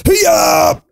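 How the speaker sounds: high martial-arts yell; karate shout. Pretend. Jumping across the gap.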